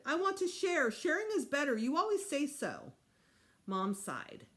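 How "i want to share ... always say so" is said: put-on pleading child. Child demanding to share.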